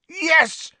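very high cry of victory; triumphant yell. A narrator's reading. Seeker who has just caught the snitch, triumphant yell. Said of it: "Harry yelled".

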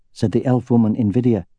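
ordinary delivery, nothing marked

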